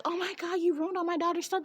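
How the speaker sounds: high, fast scandalized mother; outraged parent. Retold or imitated. Parents find the wrecked room, high dismayed cry. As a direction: squeal it like an upset mom. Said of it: "her mom and dad like".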